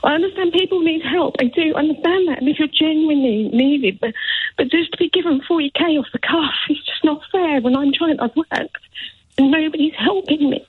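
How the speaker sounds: distressed, high-pitched plea; emotional. Real, not acted. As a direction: plead through rising tears, pitch held high. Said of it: "Of course you're getting emotional".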